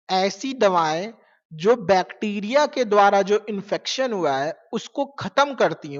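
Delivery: usual speaking voice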